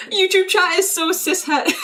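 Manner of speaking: high, laughter-filled exclamation; laughing. Real, not acted. Streamer cracks up at YouTube chat, real laughing voice. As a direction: squeeze the words out through real laughter.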